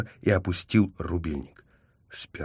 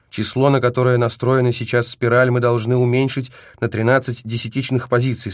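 raised, louder instruction; very insistent; 5 semitones higher, 5 dB louder than usual. A narrator's reading. Koipu's orders on resetting the spiral, insistent. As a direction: drive the instruction home. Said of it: "Он очень настаивал".

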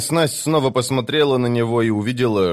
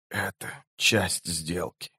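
slow, hushed, lowered voice of a dying man; speaking quietly; 4 semitones lower, 10 dB quieter, slower than usual. A narrator's reading. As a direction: say it very softly and slowly. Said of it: "тихо проговорил он". (тихо, медленно и низко)

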